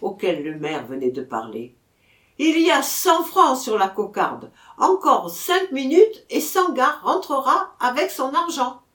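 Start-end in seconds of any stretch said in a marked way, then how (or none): 2.4-8.8 high, loud public announcement; announcing cry. A narrator's reading. Bugler announces prize on the cockade, high loud call. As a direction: project it across the arena.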